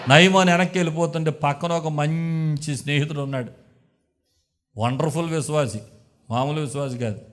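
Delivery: ordinary delivery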